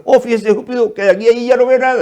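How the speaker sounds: hamming up the customer's exaggeration; mock exclaim.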